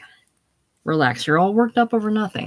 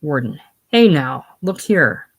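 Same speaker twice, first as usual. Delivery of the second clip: ordinary talk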